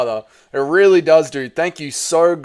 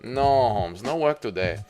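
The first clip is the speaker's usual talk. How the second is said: sing-song, hammed-up Mexican accent; mock Mexican accent; 3 semitones lower than usual. Streamer practising for chat as a work-shy Mexican, mock Mexican accent. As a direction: put on a bad Mexican accent, stretching the vowels. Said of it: "I need to work on my Mexican".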